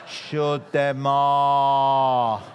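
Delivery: ordinary talk, nothing marked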